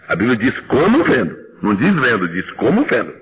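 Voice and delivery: "como" pressed, "vendo" falling; stress on como. Shown as a demonstration. Preacher drives home the verse, stressing "como". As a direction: lean on "como" each time. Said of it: "preacher repeats with stress".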